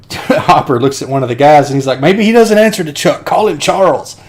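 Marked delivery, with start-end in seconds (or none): 2.0-4.2 high, raised-voice movie quote; acted exasperated suggestion. Hopper to one of the guys, mock exasperated. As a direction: toss it out as a hammed-up order. Acts Deacon's exasperated favorite line.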